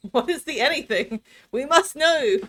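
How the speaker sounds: building to an eager cry; excited. Real, not acted. Streamer hyping up the viewers' curiosity, excited.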